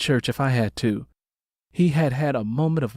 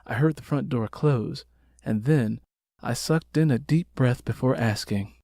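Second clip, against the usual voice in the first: ordinary delivery, nothing marked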